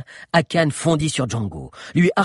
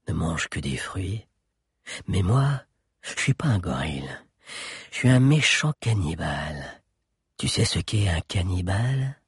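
menacing giant; 4 semitones lower than usual. A narrator's reading. Giant scaring the guard, menacingly hushed. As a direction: savour the threat.

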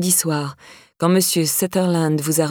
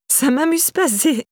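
high-pitched, frustrated objection; frustrated protest; 8 semitones higher, slower than usual. A narrator's reading. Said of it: "poussa un soupir de frustration".

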